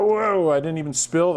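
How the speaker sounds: sudden high pleased whoa; relieved surprise at not spilling; surprised whoa. Real, not acted. Didn't spill the broccoli tray.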